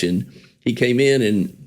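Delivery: ordinary talk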